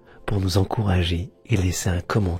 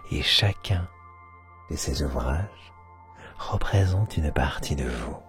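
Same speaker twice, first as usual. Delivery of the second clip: guided meditation; 3 semitones lower, 4 dB quieter than usual. Acted. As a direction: guide them into sleep, half whispering.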